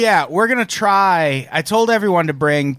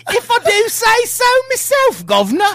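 much higher, hammed-up Cockney-ish British voice; mock British accent; 4 dB louder than usual. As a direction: show off a cartoonish British accent. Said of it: "I know my way around a British accent".

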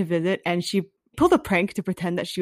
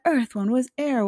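ordinary delivery

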